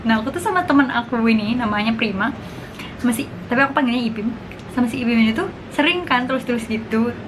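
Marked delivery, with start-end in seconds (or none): none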